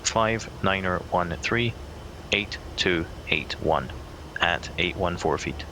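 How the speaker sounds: each digit spoken slowly; slow and deliberate. Real, not acted. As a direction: read the numbers back slowly and clearly. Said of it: "say again after Charlie Mike".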